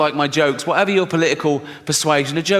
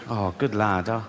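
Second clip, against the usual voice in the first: softer, lower proud murmur; fond dad; 6 semitones lower, 4 dB quieter than usual. Retold or imitated. Father softly and lower praising his sporty son. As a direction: melt with pride at the lad.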